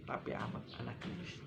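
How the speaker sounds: fast hushed whisper; dramatic whisper. Acted. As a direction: whisper it like a dark secret. Dramatic aside in sermon. (bisikan dramatis)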